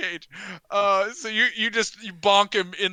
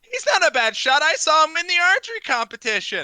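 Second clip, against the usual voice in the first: loud, high-pitched taunting yell; jeering heckler; 10 semitones higher, 8 dB louder than usual. Acted. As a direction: heckle at full voice from the crowd. Game master voices the heckler.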